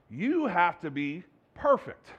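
slow, weighty "You have to be perfect"; slow deliberate command. Retold or imitated. Jesus demanding perfection, slow and weighty. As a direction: lay each word down slowly. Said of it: "Jesus comes out and says".

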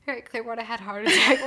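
quick, laughing tongue twister; fast. Shown as a demonstration. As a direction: rush it while laughing. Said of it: "say it five times fast".

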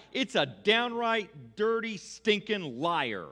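loud and slow, leaning on each word; mock-indignant denunciation. Preacher condemning pumpkin pie, loud drawn-out mock outrage. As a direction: hammer each insult with comic outrage.

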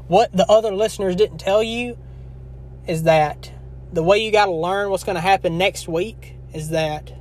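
ordinary talk

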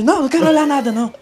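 high, loud frightened refusal; mock panicked no, pitched high and loud; scared refusal. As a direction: imitate a terrified dad refusing to look. Dad refusing to look.

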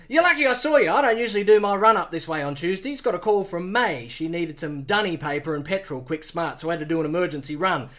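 yarn like a local bloke; Australian accent. Acted. Pilot Steve-O chatting to Bond, Australian accent. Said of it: "Australian accent".

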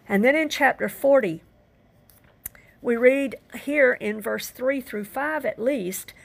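ordinary delivery

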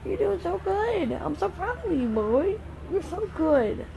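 high, sing-song praise; squeaky praising a dog. Real, not acted. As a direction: gush over him in a high voice. Praising her son in the pool.

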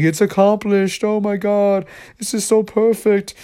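high, drawn-out artist's rapture; freaking out. Acted. Crazed artist sees finished artwork, freaking out. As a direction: gush in a high put-on voice. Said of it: "he's, like, freaking out".

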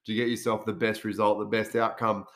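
usual speaking voice

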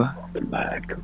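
breathy, hushed seductive whisper; sexy whisper. Pretend. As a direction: breathe it softly like a seductive intro. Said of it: "sexy voice intro for ya".

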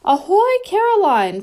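high, loud hearty hail; loud old man calling down. A narrator's reading. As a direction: boom a cheery greeting from above. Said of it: "called the crazy old man upstairs".

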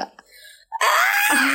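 very high squealed "ah"; remembering a sweet scene, high squeal; delighted squeal. Retold or imitated. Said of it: "Y yo".

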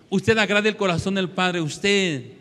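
preaching at full force. Acted.

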